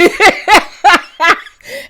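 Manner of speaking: high, loud burst of cackling; loud cackle. Real, not acted. Host cracking up at the insult, loud cackle. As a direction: cackle out in delight. Laughing at the fight recap.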